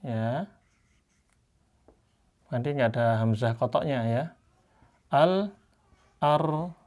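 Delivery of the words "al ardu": Al-ardu drawn out very slowly; Quranic recitation. Shown as a demonstration. Teacher demonstrating the Indonesian mushaf reading, slow recitation. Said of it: "Al-ardu ya seperti ini".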